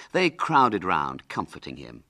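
ordinary delivery

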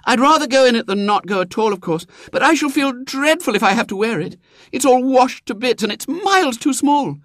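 put-on high eager girl; a poor girl hoping; little girl voice. A narrator's reading.

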